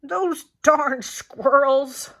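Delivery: put-on slow, loud, drawn-out cranky old man shout; Old Man Fookwire yelling. A narrator's reading. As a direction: bellow it like a furious old grump. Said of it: "yelled Old Man Fookwire".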